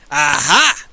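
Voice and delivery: triumphant. Acted.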